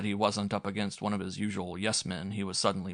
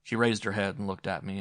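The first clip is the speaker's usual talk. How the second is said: ordinary delivery, nothing marked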